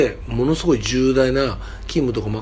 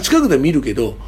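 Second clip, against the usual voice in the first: normal talking voice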